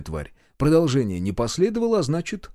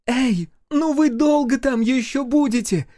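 high, slow call from afar; high-pitched, stretched-out yell; impatient shout; 12 semitones higher, 4 dB louder, slower than usual. A narrator's reading. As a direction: holler out the window, drawn out. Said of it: "крикнула Гюрза".

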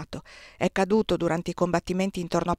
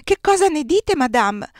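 much higher and much louder, pouncing; assailing outburst; 8 semitones higher, 8 dB louder, slower than usual. A narrator's reading. The massive marshal's wife storms in and assails Désirée, loud. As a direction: swoop on her with the question. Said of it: "mi aggredì".

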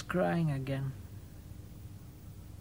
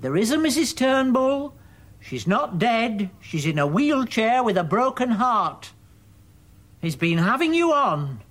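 high, loud insistence; heated contradiction; 9 semitones higher, 6 dB louder than usual. Acted. Son correcting his mother about the wife, heated contradiction. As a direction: drive the truth home, voice raised.

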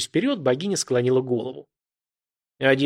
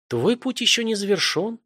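goddess voice; 8 semitones higher than usual. A narrator's reading. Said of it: "тихий голос богини".

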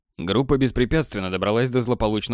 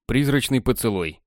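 ordinary delivery, nothing marked

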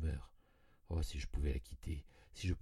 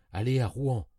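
loud, higher order to the coachman; joyful command; 5 semitones higher, 9 dB louder than usual. A narrator's reading. Narrator ordering his coachman to Rouen, loud joyful command. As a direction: bark it out in relief.

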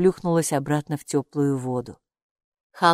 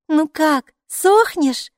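put-on bright, higher, louder girlish question; playful asking; 11 semitones higher, 6 dB louder, much slower than usual. A narrator's reading. Girl playfully asks the robe, bright excited question. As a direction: splash about and tease the drying robe. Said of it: "спросила она, плескаясь, точно дельфин".

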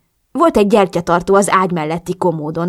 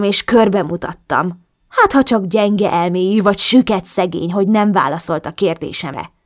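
usual speaking voice

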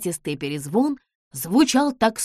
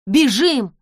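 louder, urgent yell; shouting; 6 semitones higher, 9 dB louder than usual. Acted. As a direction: yell to run. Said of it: "закричала Нэнси".

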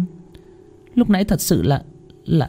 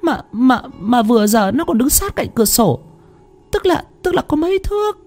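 much higher, rushed, stammering protest; terrified wife insisting she saw the ghost; desperate pleading. Acted. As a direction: plead to be believed, frantic with fear. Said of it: "Thanh phân trần".

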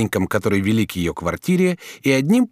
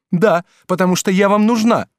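higher, louder tart comeback; indignant girl's jab; sharp retort; 9 semitones higher, 5 dB louder than usual. A narrator's reading. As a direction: cut him down. Gervaise retorts.

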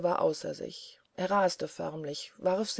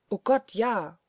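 mildly horrified cry, a little louder; shuddering exclamation; 2 semitones higher, 4 dB louder than usual. A narrator's reading. Helene recalls a buried relative, mild shuddering exclamation. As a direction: exclaim with a touch of dread. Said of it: "rief Helene schaudernd".

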